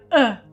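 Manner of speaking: short relaxed uh; a quick neutral uh, mouth loose; short schwa uh; schwa sound. Shown as a demonstration. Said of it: "Es este sonido".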